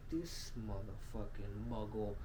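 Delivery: mock disdain for an unworthy suitor; acted disdain. Elf father on his daughter's suitor, low disdain.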